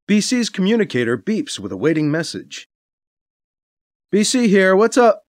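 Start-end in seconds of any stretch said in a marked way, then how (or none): 4.1-5.3 loud quick greeting; brisk answer. Acted. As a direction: pick up tersely. Answering communicator.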